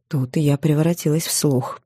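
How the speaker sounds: plain reading voice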